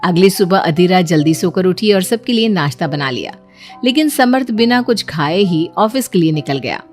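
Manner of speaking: usual speaking voice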